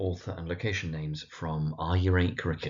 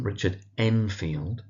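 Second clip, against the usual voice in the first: normal talking voice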